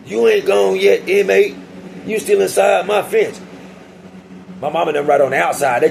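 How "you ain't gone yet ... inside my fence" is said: slow, higher, bossy guard voice; taunting prison guard. Retold or imitated. Guard taunting the inmate at the gate, slow and bossy. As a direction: lord it over the inmate, a bit higher. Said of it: "He talking about".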